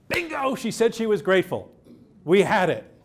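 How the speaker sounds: a reporter's gotcha moment; we got it, punch it out; excited. Real, not acted. The triumph of getting the quote.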